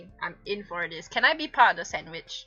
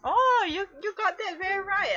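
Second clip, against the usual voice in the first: teasing; 10 semitones higher than usual. Real, not acted. Teasing the listener.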